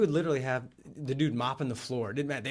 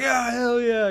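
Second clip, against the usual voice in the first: loud, high, over-eager yell; hammed-up excitement, high and loud; excited radio caller; 11 semitones higher, 10 dB louder, much slower than usual. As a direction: whoop it as a thrilled caller. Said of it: "And the guy calls in".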